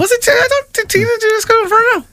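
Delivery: very high, wavering unsure voice. Real, not acted. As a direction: pitch jumps way up, unsure. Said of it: "this is my unsure voice".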